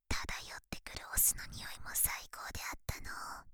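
fast close teasing whisper; breathy secret whisper into the ear. Acted. Long whispered teasing monologue.

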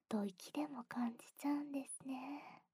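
hushed intimate whisper; soft whisper. Acted. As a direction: breathe it right into the ear.

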